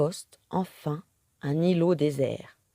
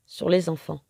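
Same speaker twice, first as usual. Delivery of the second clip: plain reading voice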